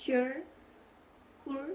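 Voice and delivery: "Her" tried out with a questioning lift; unsure pronunciation. Real, not acted. Vlogger guessing at a Korean brand's name, "Her" said unsurely. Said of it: "I'm not sure".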